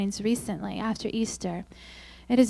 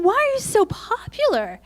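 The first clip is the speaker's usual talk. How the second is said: jealous whine; 12 semitones higher, 4 dB louder than usual. Retold or imitated. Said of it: "And I'm thinking".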